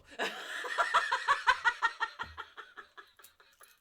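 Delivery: loud laughing snort through the nose. Real, not acted. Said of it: "Did you just snort on fucking video?".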